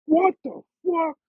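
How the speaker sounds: [name] amazed. Retold or imitated. [name] hearing the song for the first time, shrieking high in amazement. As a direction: blurt it out, blown away. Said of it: "and he's like".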